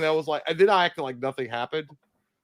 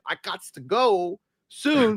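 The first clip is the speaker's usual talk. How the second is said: put-on slow drunk drawl; 5 semitones higher, much slower than usual. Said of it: "And I'm like".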